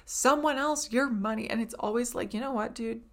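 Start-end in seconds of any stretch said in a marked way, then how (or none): 0.0-1.5 raised, drawn-out mock yell at the camera; imitating yelling. TikTok guy tells viewers to stop spending, mock yelling. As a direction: imitate his scolding yell, higher and louder. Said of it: "he's always just yelling at the camera".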